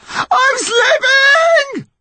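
very high, drawn-out scream; panicked yell. A narrator's reading. Mum losing her grip, slow panicked scream. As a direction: scream it as your grip goes. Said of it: "his mum yelled".

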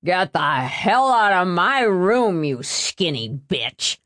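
slow, slurred angry holler; drawn-out drunken insult; drunken angry outburst. A narrator's reading. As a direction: holler it boozily, dropping at the end. Drunken brother yells at his sister.

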